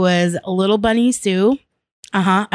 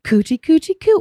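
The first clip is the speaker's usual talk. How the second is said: put-on higher cooing baby talk; 9 semitones higher than usual. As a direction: coo like teasing a kid. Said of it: "coming up and saying".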